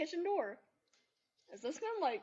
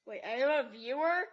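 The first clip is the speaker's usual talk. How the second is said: louder, startled squeal of a question; surprised; 10 dB louder than usual. Real, not acted. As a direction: caught off guard by a watcher. Suddenly notices a viewer.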